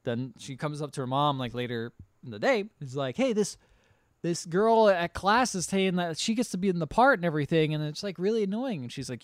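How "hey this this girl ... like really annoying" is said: higher-pitched, lightly voiced complaining kid; complaining child. Girl tells mom about a classmate, lightly voiced complaint.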